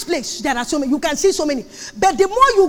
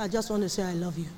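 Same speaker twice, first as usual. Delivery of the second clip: very low, hushed suitor's voice; baritone sweet-talk; 13 semitones lower, 16 dB quieter, faster than usual. Acted. Suitor phoning from a strange number, baritone sweet-talk. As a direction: drop deep and soft, quick romantic murmur. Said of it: "keeping those baritone voice for girlfriend".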